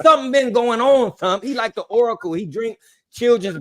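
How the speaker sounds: high excited exclamation. Real, not acted. Host ranting excitedly.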